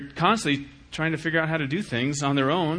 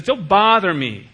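petulant; 3 semitones higher, 8 dB louder, slower than usual. Retold or imitated. Petulant God voiced.

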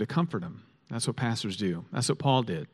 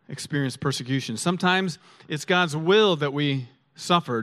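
normal talking voice

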